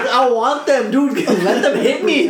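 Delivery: loud, high, laughing through a mock complaint; laughing pained plea. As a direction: beg for relief while cracking up. Retold begging for more morphine.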